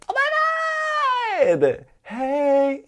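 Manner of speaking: high, loud, drawn-out squeal; very high, stretched-out party greeting; excited squeal greeting. Retold or imitated. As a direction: shriek hello to friends who just walked in. Excitedly greeting friends walking in.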